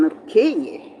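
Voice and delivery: ordinary talk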